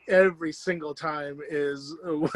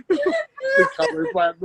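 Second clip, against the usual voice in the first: slow, high, through tears of laughter; crying with laughter; laughing; slower than usual. Real, not acted. Laughing hard repeating the phrase.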